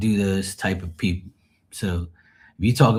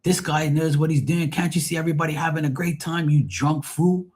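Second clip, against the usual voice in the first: retold angry captain; 6 semitones higher than usual. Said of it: "the captain of the boat turns around and says".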